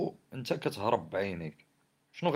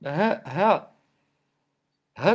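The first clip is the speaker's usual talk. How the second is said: loud; 6 semitones higher, 8 dB louder, much slower than usual. Real, not acted. Indignant rant about the state.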